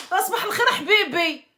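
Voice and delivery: loud, high, fast mock greeting through a laugh; harsh good morning. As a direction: bark good morning like a rough wife. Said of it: "la différence entre صباح الخير حبيبي".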